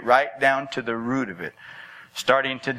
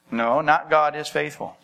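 ordinary talk

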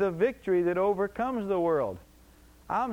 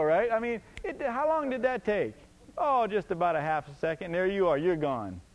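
usual speaking voice

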